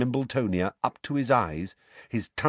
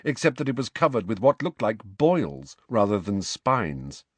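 usual speaking voice

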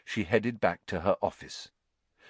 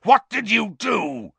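loud, high-pitched angry demand; bellow; 13 semitones higher, 5 dB louder than usual. A narrator's reading. Professor demanding what Potter did, bellowing. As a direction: roar the question at the culprit. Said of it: "he bellowed".

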